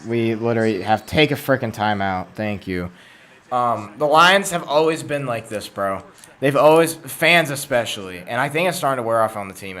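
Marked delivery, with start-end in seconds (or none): none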